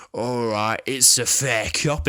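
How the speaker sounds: a light, mock Cockney accent, drawn out; fake Cockney accent.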